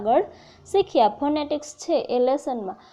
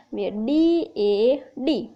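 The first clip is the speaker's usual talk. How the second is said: slow spelling out; much slower than usual. Shown as a demonstration. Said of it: "તો ડી હોય મૂળાક્ષર".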